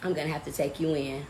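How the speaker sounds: low, soft cop voice; deadpan officer breaking the news, low. Retold or imitated. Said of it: "He come back, he's like".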